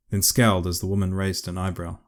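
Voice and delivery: usual speaking voice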